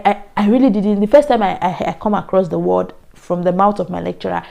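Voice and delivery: usual speaking voice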